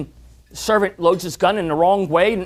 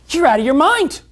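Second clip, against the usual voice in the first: higher and louder, mock-offended insistence; indignant scorpion; 6 semitones higher, 4 dB louder than usual. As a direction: scorpion scoffing at the frog's fear.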